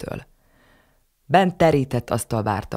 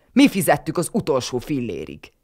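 louder, bitter and indignant; angry retort; 4 semitones higher, 4 dB louder than usual. A narrator's reading. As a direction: hit back hard at the in-law. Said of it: "csattant fel Tibor papa".

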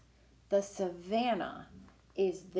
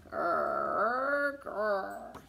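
panda growl; 2 semitones lower than usual. Acted.

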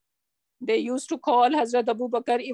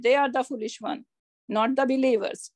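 usual speaking voice

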